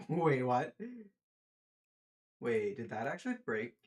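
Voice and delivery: loud startled laugh then question; surprised. Real, not acted. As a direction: caught off guard, half laughing. Surprised at block breaking.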